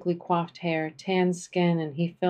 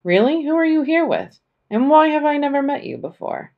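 high, loud, eager questions; genuinely taken aback; surprised; 9 semitones higher, 7 dB louder than usual. Acted. Dean seemed surprised.

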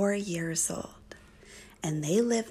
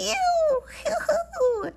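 very high whooping "woo-hoo"; exhilarated yelling; 4 dB louder, much slower than usual. A narrator's reading. Toad rides his first wave, high exhilarated whoops. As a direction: whoop high with the thrill of the ride. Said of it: "and he was yelling".

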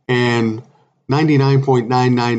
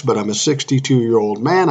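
normal talking voice

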